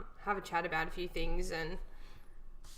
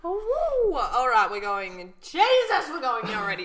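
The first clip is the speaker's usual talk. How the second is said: excited exclamation; 6 semitones higher, 9 dB louder than usual. Real, not acted. Reacting to heavy topic.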